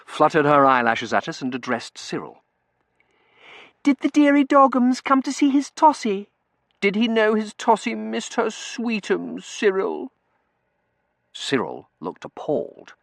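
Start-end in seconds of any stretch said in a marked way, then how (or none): 3.8-10.1 put-on very high, loud cooing girl; simpering, high-pitched pet talk; gushing baby talk. A narrator's reading. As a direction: coo at a dog like a baby. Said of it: "without a hint of baby talk".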